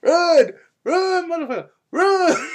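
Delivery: high, loud yelled warning; Eddie of Barbershop. Retold or imitated. Inner voice urging Kazuki to flee, frantic yelling. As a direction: bellow in hammed-up alarm. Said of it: "my inner Eddie of Barbershop".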